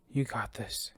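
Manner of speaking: breathy whisper under his breath; whisper to himself. Acted. Jackson steadying himself beside sleeping enemies, whispered. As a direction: hush it so the sleepers stay asleep.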